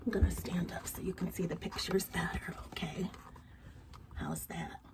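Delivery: soft voiceless whisper. Real, not acted. Teacher to child at story time, whisper. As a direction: quietly check in, barely breath. Checking child can see pictures.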